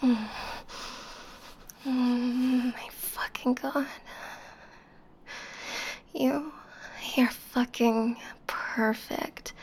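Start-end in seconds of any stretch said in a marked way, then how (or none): none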